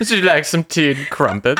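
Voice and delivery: hammed-up British accent, laughing; mock British accent. Co-host riffing on the notification, laughing mock British accent. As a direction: offer tea in a silly posh British accent. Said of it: "Don't know what character this is".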